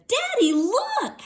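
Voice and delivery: put-on high, loud child calling daddy; little girl showing off her cart; excited little girl. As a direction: squeal it like a proud small kid. Child shows father candy.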